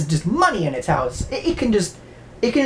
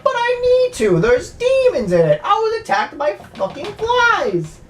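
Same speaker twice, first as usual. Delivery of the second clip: very high mock pleading; desperate priest. Priest pleads with his superior, very high mock desperation. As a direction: plead like a priest swearing about demon flies. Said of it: "the priest is like".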